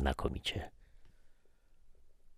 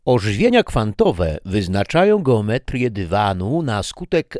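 bright upbeat video host read, louder and higher; youtuber presenter; 4 semitones higher, 6 dB louder than usual. Acted. Youtuber closing his physics episode to viewers, upbeat presenter read.

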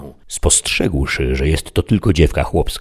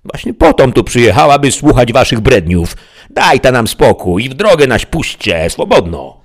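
higher, louder angry peasant yell; peasant girl shouting; 8 semitones higher, 6 dB louder than usual. A narrator's reading. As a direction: yell at him to let them go. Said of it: "krzyknęła chłopka".

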